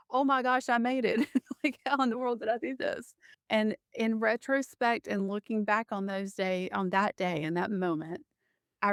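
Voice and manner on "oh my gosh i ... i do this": higher-pitched retold disbelief; amazed relief. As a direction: relive the astonished relief, half laughing. Retelling her relieved collapse after escape.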